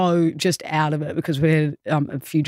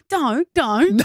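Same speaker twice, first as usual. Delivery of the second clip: higher-pitched mock scolding; embarrassed mum; 6 semitones higher than usual. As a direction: imitate a mum telling her child off. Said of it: "his mum goes".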